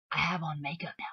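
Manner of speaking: a soft whispered aside. Real, not acted. Vlogger confides to viewers she now wears makeup, whispered. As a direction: drop to a breathy secret whisper.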